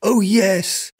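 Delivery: high, eager call; the beggar calling down to the merchant. Acted. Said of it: "I called out".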